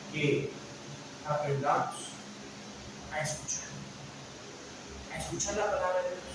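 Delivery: soft and intimate, pulling them in; sermon hush to stress listening; hushed emphasis. Acted. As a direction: drop to a near whisper. Preacher drops voice for emphasis.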